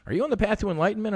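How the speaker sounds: quick, eager question like a curious seeker; mimicking people. People quizzing him, fast eager mimicry. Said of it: "people are like".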